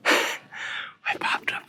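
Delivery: slow whisper after a sigh; hushed, sighing whisper. Real, not acted. Hunters near game, hushed.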